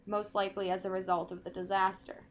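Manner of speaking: usual speaking voice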